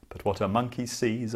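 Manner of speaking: ordinary delivery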